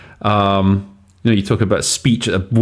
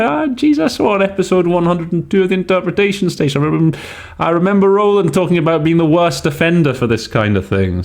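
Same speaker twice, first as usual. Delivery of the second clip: much higher, excited put-on student voice; mock learner remembering; 8 semitones higher than usual. Teacher acting out a future student's sudden recall, high and excited. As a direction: play a delighted student recalling the lesson. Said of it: "you might get that similar sort of sentence and you remember".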